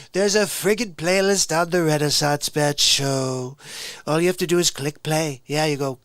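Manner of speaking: put-on presidential caricature, emphatic and boastful; Donald Trump. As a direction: stretch the phrase endings, full of self-importance. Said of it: "Donald Trump impression".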